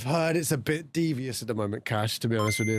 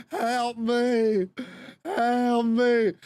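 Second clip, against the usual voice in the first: mock cry for help; 9 semitones higher, much slower than usual. Streamer voicing a cat as a captured teammate, high mock wail. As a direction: wail it like a hostage being dragged off.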